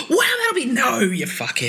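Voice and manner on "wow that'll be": high, gasping wow; mock gasp of awe. Mate gasping at the radio news, high and awed. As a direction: gasp like she's starstruck. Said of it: "She's like".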